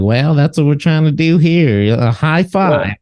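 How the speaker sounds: imitating a rodeo man egging him on. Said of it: "And he's like".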